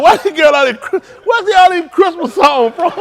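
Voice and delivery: mock complaining man. Man asking why all the Christmas songs, high mock whine. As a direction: squeak it out as an exasperated guy. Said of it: "That man told us".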